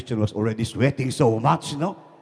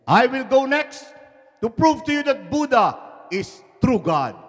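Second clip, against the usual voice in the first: loud, high proclamation; volunteer defiantly at raised volume; bold declaration; 11 semitones higher, 7 dB louder than usual. Acted. Acted volunteer for cliff jump.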